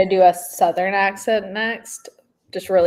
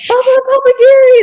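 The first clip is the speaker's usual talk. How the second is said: high, loud excited squeal; excited shout; 10 dB louder than usual. Pretend. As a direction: squeal the name with delight. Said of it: "everyone that are gonna be like".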